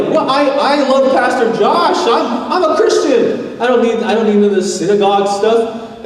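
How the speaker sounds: high-pitched, stammering objection; mock protest. Churchgoer turning down the neighbor's synagogue invite, in high mock protest. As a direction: push back, defensive and a little flustered.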